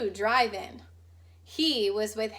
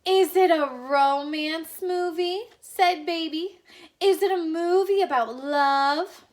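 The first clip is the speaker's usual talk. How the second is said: hopeful swoon; 6 semitones higher, 7 dB louder than usual. Acted. Old neighbor asking the Watsons, hopeful and dreamy. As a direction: ask as if love is all you want.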